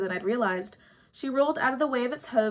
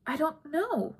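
higher, a little softer, unsure; nervous; 6 semitones higher, 3 dB quieter, slower than usual. A narrator's reading. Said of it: "Bianca said nervously".

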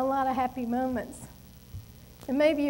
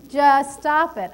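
slow, louder, firm just stop it; loud demand; 2 semitones higher, 8 dB louder, slower than usual. Retold or imitated. She demands that God stop the people hurting her family, slow and firm. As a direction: put your foot down with God.

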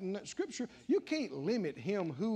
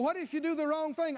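ordinary talk, nothing marked

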